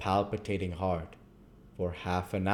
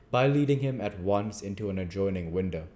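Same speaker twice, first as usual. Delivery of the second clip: plain reading voice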